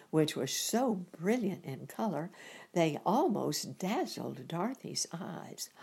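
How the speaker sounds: usual speaking voice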